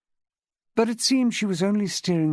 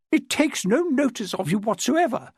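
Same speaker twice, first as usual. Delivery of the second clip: higher, peevish complaint; complaining; 7 semitones higher than usual. Acted. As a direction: whine it at the owner. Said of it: "Miss Chilmark complained".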